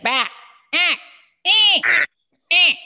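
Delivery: put-on toddler babble shouts; high, loud baby squeals; imitating a loud toddler. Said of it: "the noise you would hear from him".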